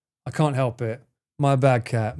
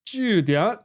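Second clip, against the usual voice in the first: "Julian" drawn out with a dip and a rise; down-then-up tone; 3 semitones higher than usual. Shown as a demonstration. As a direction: stretch "Julian", sagging then lifting. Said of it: "start the pitch going down and up".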